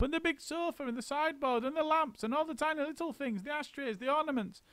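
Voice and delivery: very high, fast rattling list; high rushing monkey list; frantic list. A narrator's reading. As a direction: reel it all off breathlessly. Mugglewump listing orders.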